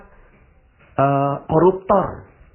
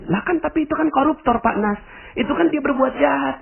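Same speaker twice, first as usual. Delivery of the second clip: shrill protest, much higher; a listener pushing back in disbelief; high objecting listener. Pretend. Audience member protests to Pak Nas, high indignant objection.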